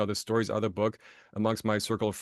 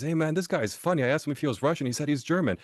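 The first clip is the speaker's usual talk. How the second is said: higher, amused retold aside to a buddy; amused mock report; 7 semitones higher than usual. Fraternity guy tells his buddy about the funny newcomer, amused. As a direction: imitate a guy sharing a joke, pitched up.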